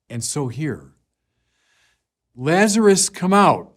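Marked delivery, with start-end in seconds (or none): 2.3-3.7 loud, higher ringing call; commanding shout into the tomb. Acted. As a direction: call him out of the tomb with full voice. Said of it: "in a loud voice".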